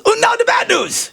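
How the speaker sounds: put-on high, booming German accent; German commandant accent. Joke told to the host, mock German accent. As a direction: announce it like a German camp commandant. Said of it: "talking in a German accent".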